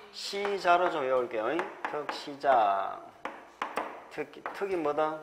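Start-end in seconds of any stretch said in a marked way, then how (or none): 1.9-3.0 slow mnemonic. Shown as a demonstration. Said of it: "요걸 거꾸로 좀 외우겠습니다".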